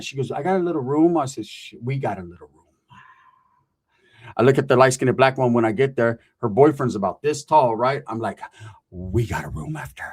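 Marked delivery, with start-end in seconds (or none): 1.4-2.5 low, soft mock seduction; low suggestive echo. 8.9-10.0 very low, hushed mock come-on; low suggestive aside.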